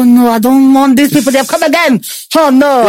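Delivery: high-pitched, hammed-up groan of reluctance; retold "not them" wail; exasperated dismay. Acted dismayed reaction to unwanted message.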